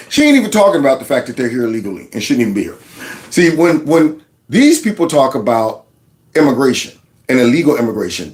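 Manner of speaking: normal talking voice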